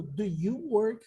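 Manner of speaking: slow model question. Shown as a demonstration. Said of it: "Si yo te pregunto".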